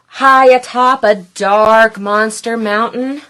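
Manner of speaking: plain reading voice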